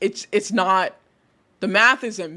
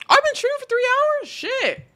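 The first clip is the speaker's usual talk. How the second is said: surprised. Real, not acted. Realizes stream length.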